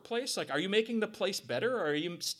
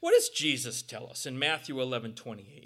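normal talking voice

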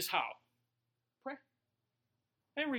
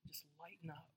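much softer, hushed; God's whisper; 2 semitones lower, 18 dB quieter than usual. Retold or imitated. God whispering to the sick pastor, hushed. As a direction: breathe it gently. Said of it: "That's all he whispered to me".

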